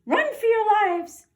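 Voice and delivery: high, loud squeaky panic; screaming mice. Acted. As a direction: shriek it like terrified little mice. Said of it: "screamed the soldiers".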